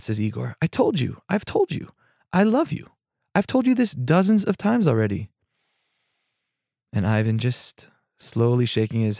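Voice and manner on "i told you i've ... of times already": high-pitched, pleading protest; exasperated insistence. Acted. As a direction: insist as the fed-up friend, without shouting. Igor insists in an acted quarrel.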